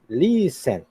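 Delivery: listen stressed on its first syllable, LIS-en; listen said slowly as LEEE-sen, weight up front. Shown as a demonstration. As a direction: stretch the LIS of listen, let -ten fall away. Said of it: "sino la primera".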